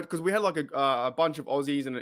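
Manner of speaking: ordinary talk, nothing marked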